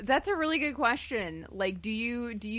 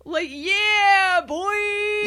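loud high hype shout; [name] yell; 10 semitones higher, 9 dB louder, much slower than usual. Pretend. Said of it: "a [name] thing".

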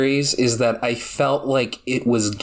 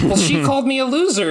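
high, fast and giggling; whiny villain voice; 12 semitones higher, faster than usual. Retold or imitated. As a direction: whine it like a hurt kid. Villain whining, laughing.

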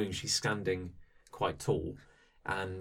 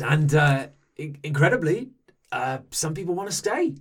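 raised, lightly voiced NPC; character voice; 7 semitones higher, 8 dB louder than usual. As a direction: voice the guest, a bit higher. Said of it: "He goes".

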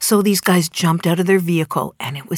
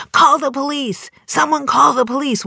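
a neighbor's mock shout, pitched up high; called loudly; 9 semitones higher, 4 dB louder than usual. Neighbor on the lawn calling out for police, a raised retold shout. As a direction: yell it like a bystander sounding the alarm. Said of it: "one of them called loudly".

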